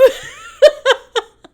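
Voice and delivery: high loud real laugh; burst of laughter. Real, not acted. Laughing at her own story.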